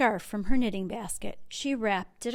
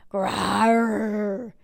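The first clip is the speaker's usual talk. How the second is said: bear growl; 4 dB louder than usual. A narrator's reading. Bear answers teasing.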